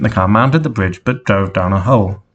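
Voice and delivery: ordinary delivery, nothing marked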